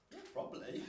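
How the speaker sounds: high breathy laugh through it; laughing admission. Retold or imitated. Preacher telling the pupil he's probably mad, laughing. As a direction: admit it while laughing.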